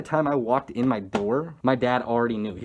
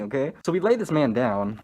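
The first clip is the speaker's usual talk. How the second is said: ordinary delivery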